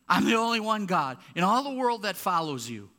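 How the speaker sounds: high, loud, complaining plea; whining Elijah. Retold or imitated. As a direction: whine it out to God like a sulking prophet. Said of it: "Elijah is whining".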